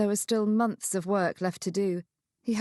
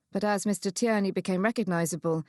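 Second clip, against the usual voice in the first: ordinary delivery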